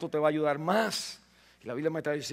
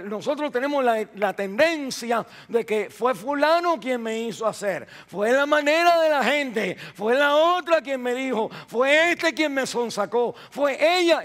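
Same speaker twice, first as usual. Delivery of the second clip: agudo y fuerte, acusando; predicación a gritos; 7 semitones higher, 7 dB louder than usual. Real, not acted. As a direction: clamar contra las excusas. Imita excusas de la gente. (voz alzada enumerando culpables)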